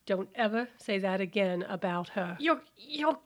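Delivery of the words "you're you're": high, pinched with pain; strained pain. Acted. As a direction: gasp it out in agony. Hand being crushed.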